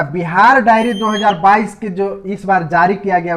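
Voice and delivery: ordinary delivery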